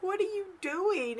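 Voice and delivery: high, slow, retold question; incredulous. Asking Gary why he's outside her door, incredulous. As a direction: stretch it out high in disbelief.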